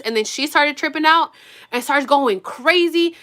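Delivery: ordinary talk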